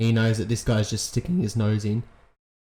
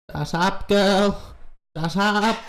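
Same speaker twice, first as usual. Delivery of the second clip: aggravated monkey; 9 semitones higher, slower than usual. Acted. Golden monkey snapping at the girl, high and irritated. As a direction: snap at the girl like an angry monkey. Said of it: "The monkey's getting aggravated".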